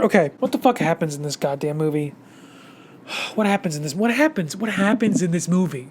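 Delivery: low, soft, fast grumble under the breath; frustrated mutter. Real, not acted. Reviewer grumbling to herself about the plot, fast soft mutter.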